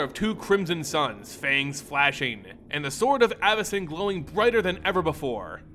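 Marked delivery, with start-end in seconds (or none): none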